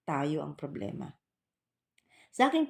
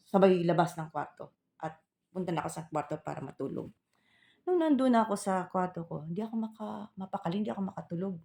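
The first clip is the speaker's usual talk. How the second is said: usual speaking voice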